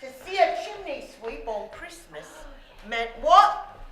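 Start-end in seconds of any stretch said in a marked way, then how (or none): none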